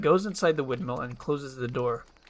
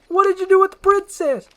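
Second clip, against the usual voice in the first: high, loud accusing shout; terrified yelling accusation; panicked demand; 11 dB louder than usual. Acted. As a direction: demand answers from a monster. Donkey confronts supposed monster.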